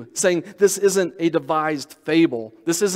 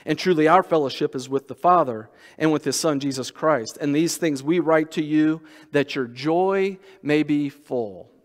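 ordinary talk

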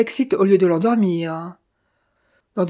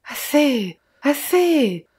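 rising outcry, higher on the second; crying out; 5 semitones higher, much slower than usual. A narrator's reading. Philippe begging Austin to stop accusing him, crying out. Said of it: "s'écria Philippe".

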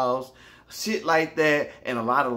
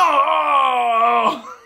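startled scream; 12 semitones higher, 9 dB louder than usual. Retold or imitated. Scream on waking.

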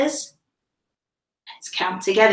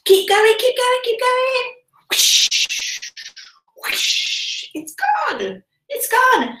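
much higher, thrilled cheering; kids show host; 9 semitones higher than usual. Acted. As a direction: cheer the little ones on. Urging kids, sock gone.

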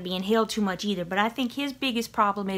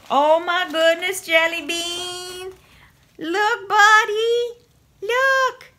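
high, loud cooing to a cat; excited pet talk; 8 semitones higher, 5 dB louder, much slower than usual. Real, not acted. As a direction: squeal to get the cat's attention. Excitedly showing a toy to cat.

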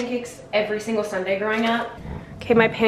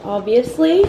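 ordinary talk, nothing marked

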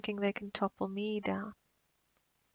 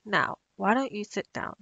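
ordinary talk

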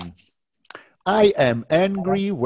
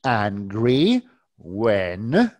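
slow, drawn-out teacher model; slow model sentence; 3 semitones lower, much slower than usual. Shown as a demonstration. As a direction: stretch each word for the learner. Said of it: "Okay, let's try".

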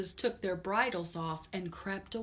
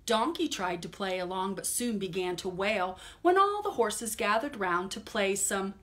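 put-on lively read-aloud to children; storytime reading; 6 dB louder than usual. Teacher reading a picture book to kids, lively storytime voice. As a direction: read it brightly to little listeners.

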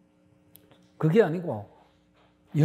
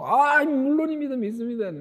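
eager assurance; 7 semitones higher than usual. Retold or imitated. Man eagerly assures God.

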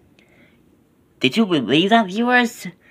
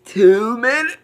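excited; 8 semitones higher than usual. Real, not acted. Repeating time, playful.

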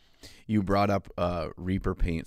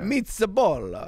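much higher, slow, hammed-up Italian exclamation; mock Italian accent; 12 semitones higher, 6 dB louder, slower than usual. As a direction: toss out a cartoon Italian word like a bad Borat. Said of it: "like Borat instead of a true Italian".

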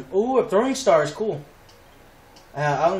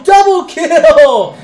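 "double kill" yelled high, loud and drawn out; triumphant shout; 9 semitones higher, 14 dB louder, much slower than usual. Real, not acted. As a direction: shout it out slowly in sheer triumph.

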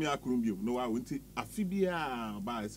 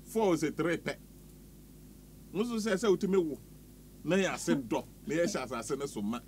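playful preacher-style blessing, a little louder; mock prayer; 5 semitones higher, 3 dB louder than usual. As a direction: pronounce a blessing like a pastor, half joking. Mock blessing prayer over listener.

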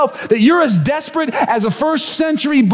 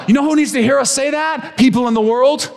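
ordinary talk, nothing marked

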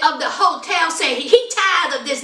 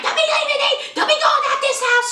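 high whiny pleading, rushed at the end; hammed-up begging man, much higher; squeaky plea to stay; mock Shane; 13 semitones higher, faster than usual. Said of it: "Shane was like".

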